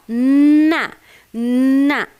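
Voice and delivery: loud "na, na" with a long held n; demonstrating the sound. Shown as a demonstration. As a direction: hold the n, then push out na. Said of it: "Eu vou falar". ("na" forte, com o "n" prolongado)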